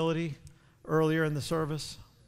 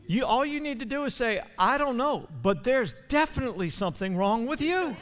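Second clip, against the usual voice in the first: raised, fervent preaching to the crowd; preaching hard; 5 semitones higher, 6 dB louder than usual. Acted. Pastor pressing the congregation about the enemy's lies, fervent preaching. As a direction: drive it home from the pulpit, louder.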